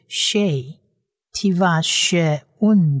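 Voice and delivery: slow, drawn-out she, tivashevun; slow sounding out. Shown as a demonstration. Teacher slowly models the syllable for students. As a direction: let each syllable hang. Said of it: "是长母音a".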